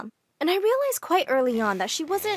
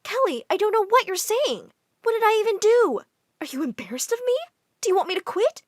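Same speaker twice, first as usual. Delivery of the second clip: 1 defensive mom; 8 semitones higher than usual. Retold or imitated. Mom gets defensive, near tears.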